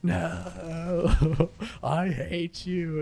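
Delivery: high, slow hammed-up wail; mock Anakin. Gamer mocks burnt Anakin's final scream, high and slow. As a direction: overplay the burning hero's cry. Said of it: "he goes".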